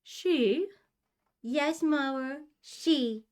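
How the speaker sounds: high, drawn-out put-on ooh voice. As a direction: answer mother in a high, silly ooh-ooh voice. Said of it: "My tone is dry as I try not to laugh".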